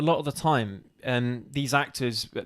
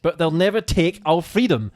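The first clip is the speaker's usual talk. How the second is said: higher Bristolian attempt gone Scottish; accidental Scottish accent; 4 semitones higher, 6 dB louder than usual. Player failing at a Bristolian film quote, slipping into Scottish accent. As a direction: declaim it in a bad Scottish accent. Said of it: "See, I keep going Scottish".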